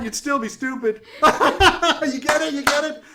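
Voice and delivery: high, loud insult comic laughing at himself; boisterous heckler with a big laugh; self-amused jokester. Acted. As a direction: crack yourself up between jibes.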